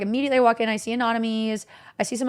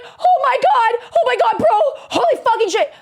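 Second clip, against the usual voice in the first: much higher, louder gushing; starstruck fan; 12 semitones higher, 5 dB louder than usual. Retold or imitated. Fan runs up to the smokers, squealing excitedly. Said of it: "he's like".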